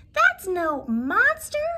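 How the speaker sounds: emphatic storybook hero cry; loud bark. A narrator's reading. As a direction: cry it out boldly, word by word. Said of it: "cried Oscar with a loud bark".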